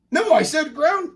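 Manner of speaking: high, loud, slow denial; insistent dad. Acted. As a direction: protest stubbornly over a mistake. Dad insisting, starting to doubt.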